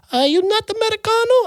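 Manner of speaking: very high-pitched waiter voice; Italian waiter. Retold or imitated. Waiter questioning the diner, high mock waiter voice. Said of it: "The waiter came over".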